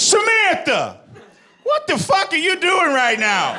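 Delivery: very high strained outburst, slowed; high disbelieving mock shout at Samantha; exasperated Kylo Ren. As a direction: squeal it like a costumed villain losing it. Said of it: "And he just goes".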